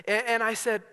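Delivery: ordinary delivery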